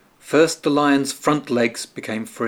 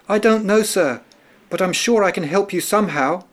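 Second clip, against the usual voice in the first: high mouse voice, hurrying the last line fast; eager little mouse; 7 semitones higher, faster than usual. A narrator's reading. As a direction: insist up high like a tiny mouse. Mouse answers the lion.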